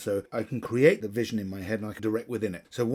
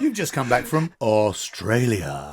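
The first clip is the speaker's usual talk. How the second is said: slow, louder, weight on "Australia"; slow emphatic correction; 6 dB louder, slower than usual. Shown as a demonstration. Colleague feeds James the corrected line, slow and emphatic. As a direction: draw out "Australia" to drive it home.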